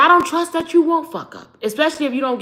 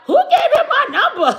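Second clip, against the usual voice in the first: laughing quote; 11 semitones higher, 4 dB louder, much faster than usual. Retold or imitated. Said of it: "She was like".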